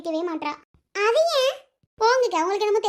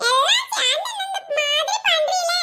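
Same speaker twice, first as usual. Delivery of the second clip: laughing complaint; 7 semitones higher, slower than usual. Acted.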